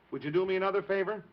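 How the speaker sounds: usual speaking voice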